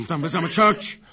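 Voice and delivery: loud, high command; sharp order. Acted. Woman ordering the gunman to leave, sharp shout. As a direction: shout him out the door.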